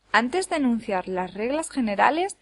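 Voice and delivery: ordinary talk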